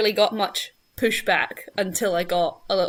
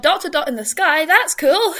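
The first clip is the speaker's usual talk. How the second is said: put-on excited child; 8 semitones higher, 5 dB louder than usual. Quoting childhood self's excitement.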